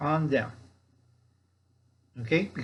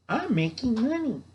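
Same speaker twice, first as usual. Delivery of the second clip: higher, gloating mock voice of the leader; mocking Captain Crazy; 8 semitones higher than usual. [name] mocking Captain Crazy, a higher gloating voice. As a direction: lightly sneer it like a greedy leader bragging. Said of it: "What Captain Crazy is doing".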